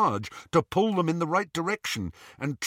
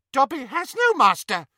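high, loud elf squeal; 10 semitones higher, 5 dB louder than usual. Acted. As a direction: squeal it defiantly. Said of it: "squealed the elf".

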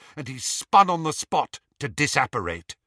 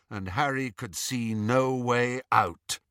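plain reading voice

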